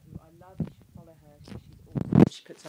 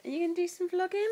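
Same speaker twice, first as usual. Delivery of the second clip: high, raised singsong question; 11 semitones higher, 7 dB louder than usual. Real, not acted. Mum asking baby about vlogging, high baby talk.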